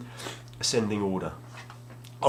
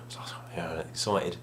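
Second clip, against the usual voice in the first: whisper; 4 semitones lower, 7 dB quieter than usual. Real, not acted. Hushed aside at the takeaway.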